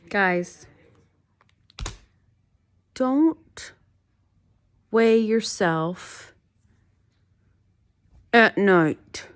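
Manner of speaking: loud, high, word by word warning; real frustration, each phrase separated by long pauses; exasperated warning. Real, not acted. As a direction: spell it out slowly and forcefully, fed up. Frustrated warning to viewers after weigh-in.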